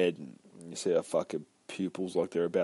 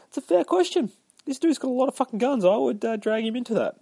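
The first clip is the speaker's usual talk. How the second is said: a much higher mock voice for a joke; silly high voice; 5 dB louder, faster than usual. Reviewer jokes to viewers in a high mock voice. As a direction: pitch way up and play it silly.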